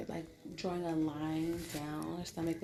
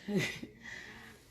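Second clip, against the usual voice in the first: breathy laugh; 3 semitones higher than usual. Real, not acted. Laughing at chokehold image.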